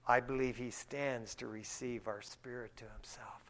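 hushed, breathy whisper of awe. Real, not acted. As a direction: drop to a reverent whisper. Preacher softly describing heaven.

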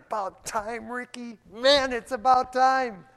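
high, loud, joyful welcome; Jesus welcoming. Acted. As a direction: greet him like a long-lost friend. Voicing Jesus welcoming him.

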